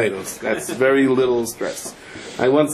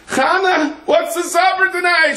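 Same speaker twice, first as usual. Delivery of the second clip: calling out. Retold or imitated. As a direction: yell it out to her back. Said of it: "And he calls out".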